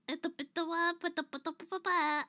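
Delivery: hammed-up stuffy nose voice; mock her own blocked nose; playing up nasal voice. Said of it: "I can't stop making fun of how I sound".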